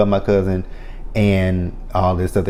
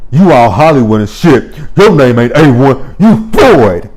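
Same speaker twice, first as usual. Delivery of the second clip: louder and higher, building to a yell; mock angry insult; 4 semitones higher, 7 dB louder than usual. Mother insulting her son after being put out, yelling. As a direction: fling the insult up top. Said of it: "She's like".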